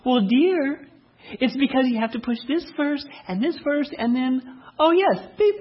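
sweet, kindly wife. Acted. Wife shows husband which button to push, sweetly and kindly. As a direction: imitate a wife sweetly explaining. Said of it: "comes over so sweetly and so kindly".

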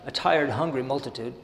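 measured sermon reading of the text; steady devotional reading voice; reading aloud. Acted. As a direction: read the passage out to the congregation. Reads the passage aloud.

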